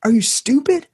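high, exasperated jab; incredulous scolding. Acted. Man in the hole scolds the friend who jumped in, exasperated. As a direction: call him an idiot in disbelief.

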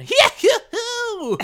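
high, slow, squealing hee-hoo-hoo; imitating a cartoon laugh. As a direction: mimic a goofy cartoon laugh. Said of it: "You went".

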